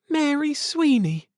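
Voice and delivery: high, startled calling out a name; surprised exclamation. A narrator's reading. Wife recognising the child, high exclamation. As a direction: cry out in recognition. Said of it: "Cynthia exclaimed".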